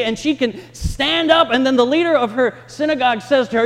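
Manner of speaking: loud, high, driving sermon voice; impassioned preaching. Real, not acted. Pastor to congregation, loud impassioned preaching. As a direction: preach it out with rising fervor.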